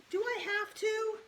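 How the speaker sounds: high, whiny protest; whining. A narrator's reading. Peter whining to his mother about babysitting, high. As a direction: complain like a sulky kid.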